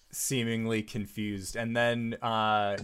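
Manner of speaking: ordinary talk, nothing marked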